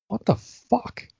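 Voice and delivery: slow, drawn-out mock disbelief; imitating a friend's slow shocked what the fuck; stunned retelling. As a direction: stretch it out like you can't believe it. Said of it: "He goes".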